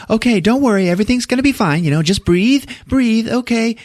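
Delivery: soothing nurse. Retold or imitated. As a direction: coax her to breathe calmly. Nurse calming woman in labor.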